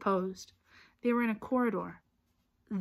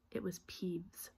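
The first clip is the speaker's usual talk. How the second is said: hushed whisper; 3 semitones lower, 13 dB quieter than usual. A narrator's reading. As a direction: breathe it out secretly. Narrator whispers the reveal.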